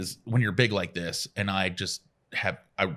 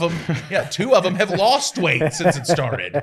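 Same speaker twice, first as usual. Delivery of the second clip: laughing; 8 semitones higher, 7 dB louder than usual. Real, not acted. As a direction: giggle while admitting it. Laughing about weight loss.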